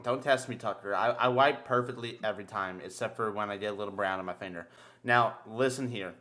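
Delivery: ordinary delivery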